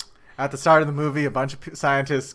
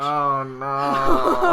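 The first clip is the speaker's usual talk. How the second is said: loud, drawn-out mock-horrified groan; playful "oh no" dread; mock dismay; 3 semitones higher, 9 dB louder than usual. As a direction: wail it in exaggerated dread, half laughing. Mock dread at next movie.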